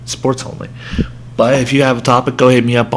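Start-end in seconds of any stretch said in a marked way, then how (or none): 0.1-0.7 whispered Sports only, barely voiced; whispered repeat. Shown as a demonstration. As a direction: breathe the words Sports only as an aside.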